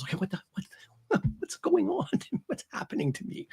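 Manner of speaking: soft, high, breathy panic; acting out a hushed, helpless question; bewildered plea. Author voices Aramis asking a priest, softly bewildered.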